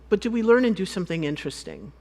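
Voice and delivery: ordinary delivery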